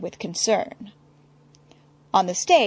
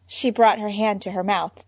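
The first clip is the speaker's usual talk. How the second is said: ordinary delivery, nothing marked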